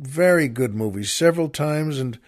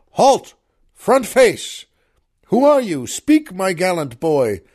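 higher, louder commanding call; general crying out; 6 semitones higher, 7 dB louder than usual. Acted. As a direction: bark the halt like a general on the field. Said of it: "Sherman cried".

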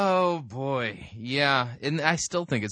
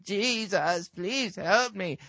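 slow, weak, mocking old beggar plea; lightly voiced frail old man; feeble old man; 6 semitones higher, much slower than usual. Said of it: "poor old man just sitting on the side of the road going".

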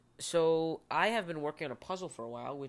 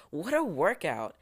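mock impressed; 4 semitones higher, 6 dB louder than usual. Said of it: "just going".

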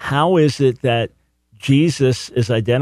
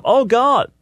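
much higher mock "Oh God" cast upward; mock heavenward cry; 11 semitones higher than usual. Mocking Thomas looking up, high exclamation. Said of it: "and then looking up".

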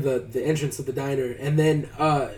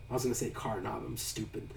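very soft, lower self-correction; muttered aside; 4 semitones lower, 14 dB quieter than usual. Real, not acted. Storyteller owning up to a slip, quietly self-mocking. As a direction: drop right down under your breath.

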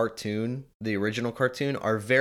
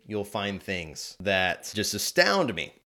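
ordinary talk

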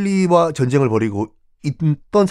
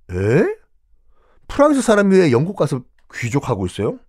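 normal talking voice

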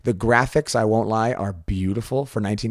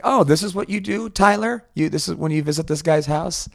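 higher-pitched mock parent's voice; puzzled parent; 6 semitones higher than usual. Parent to Tyler about this guy's house, puzzled and high. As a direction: act out a bewildered parent questioning a child.

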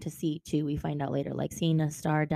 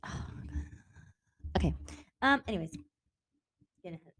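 ordinary talk

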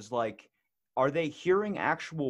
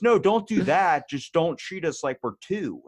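raised, louder retold protest; higher voice, insisting; emphatic objection; 5 semitones higher, 6 dB louder than usual. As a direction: push back hard, acting out his plea. Blind man's retold emphatic objection.

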